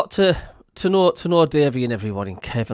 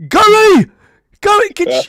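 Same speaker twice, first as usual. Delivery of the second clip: very loud, high yelled name; booming impression of an eager caller; Big Davey; 12 dB louder than usual. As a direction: yell the name down the phone, imitating a caller. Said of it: "he would just go".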